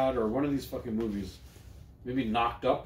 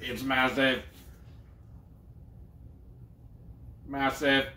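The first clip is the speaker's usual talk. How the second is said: Stephen Hawking voice; 5 dB quieter, slower than usual. Pretend. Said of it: "This is my Stephen Hawking voice".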